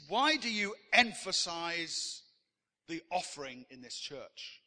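slow, loud, affronted question that drops away; offended challenge. Retold or imitated. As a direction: imitate an offended churchgoer demanding an explanation. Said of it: "he was offended".

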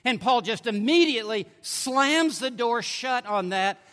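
normal talking voice

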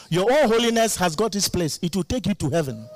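normal talking voice

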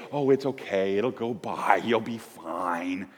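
soft, slow brush-off comfort; "there there" without really caring; dismissive soothing. Retold or imitated. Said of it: "That's not how he talks".

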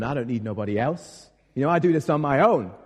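hammed-up imitation American accent. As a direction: playfully slip into an American accent. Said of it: "I did an American accent".

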